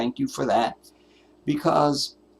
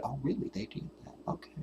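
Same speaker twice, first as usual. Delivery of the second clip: much softer, half-whispered mock surprise; 18 dB quieter, faster than usual. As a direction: breathe it out in feigned disbelief. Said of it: "We just wake up tomorrow and go".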